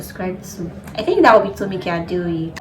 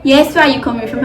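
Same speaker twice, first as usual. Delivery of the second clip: stern dad; 4 semitones higher, 7 dB louder, faster than usual. Retold or imitated. Dad demanding, repeated louder.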